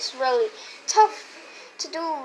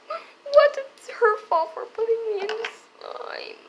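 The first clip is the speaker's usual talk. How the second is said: high whiny blaming voice; high kid voice; 8 semitones higher than usual. Pretend. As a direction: whine the blame. Toy brother blames sister.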